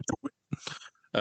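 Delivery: breathy whispered urge; whispering. Acted. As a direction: whisper it like a secret nudge. Last repeat dropped to whisper.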